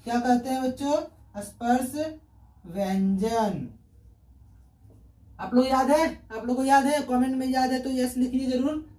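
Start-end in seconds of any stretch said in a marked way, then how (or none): 1.4-3.7 slow, word by word with a pause; drawn-out term. Shown as a demonstration. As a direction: sound the term out slowly for the class. Teacher sounding out term for class. (धीरे-धीरे खींचकर)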